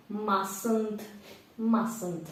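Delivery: mustn't said as masent with no t; silent t. Shown as a demonstration. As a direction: repeat mustn't without the t. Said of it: "тут буква Т не читається".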